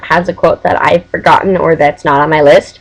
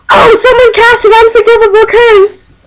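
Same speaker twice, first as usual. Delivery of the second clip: very high, hammed-up shriek; imitated cry of alarm; 4 dB louder than usual. Friend reacts to a cop car, high mock-alarmed cry. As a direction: wail it out in mock panic. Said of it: "then somebody goes".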